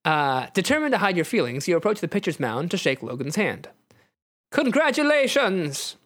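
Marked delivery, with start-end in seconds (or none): 4.5-6.0 drawn-out high character voice; high, slow congratulations in character; sincere Nightcrawler. Acted. Player's hero congratulates Logan, sincerely in a high voice. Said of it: "you say sincerely".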